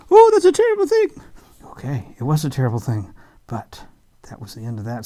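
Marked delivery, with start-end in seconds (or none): 0.1-1.1 very high, loud, fast horrified squeal; shocked falsetto. Acted. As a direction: gasp it out in mock horror. Shocked reaction voice.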